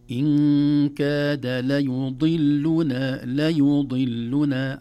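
loud, very slow chant with held nasals and vowels; recitazione coranica. Shown as a demonstration. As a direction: chant each syllable out at length. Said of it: "حركات متتابعة كثيرة".

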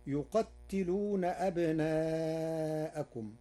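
slow tajwid chant of يقتلون; slow recitation. Shown as a demonstration. Reciter quotes the verse: slow chant. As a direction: recite it drawn out like the Quran. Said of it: "قرأ ورش يقتلون بتخفيف".

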